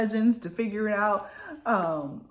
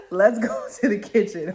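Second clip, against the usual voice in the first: louder, laughing through the quote; laughing retelling; 6 dB louder than usual. Retold or imitated. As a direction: repeat it cracking up.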